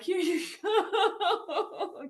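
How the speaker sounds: high, drawn-out words through laughter; giggly, stretched-out mock offer; laughing retort. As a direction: slowly crack up while mockingly handing it over. Recounts handing over car, laughing.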